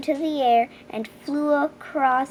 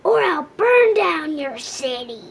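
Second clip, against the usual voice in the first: loud, higher dragon roar; 4 semitones higher, 5 dB louder than usual. A narrator's reading. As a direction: bellow the demand from the cave. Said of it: "he roared".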